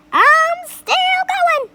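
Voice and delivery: high, loud, slow screech; screechy old voice. A narrator's reading. Grandma screeching down from the attic. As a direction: screech it out gleefully. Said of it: "came the old screechy voice".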